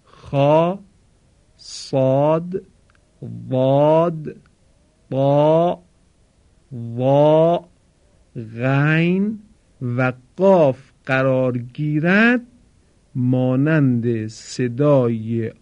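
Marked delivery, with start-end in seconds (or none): 0.0-11.6 خا، صاد، واد، با، وا، غین spelled out slowly with a heavy Arabic آ; heavy letters demo. Shown as a demonstration. Teacher spelling out the seven heavy letters for students, slow and deliberate. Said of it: "بعد از هفت حرف".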